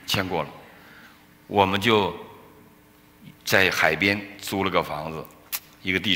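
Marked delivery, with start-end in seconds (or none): none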